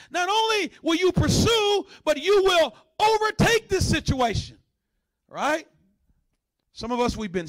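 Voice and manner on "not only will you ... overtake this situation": high, ringing proclamation; emphatic divine promise. Acted. The Lord answers the praying man, high emphatic proclamation. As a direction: proclaim it with rising preacher's force.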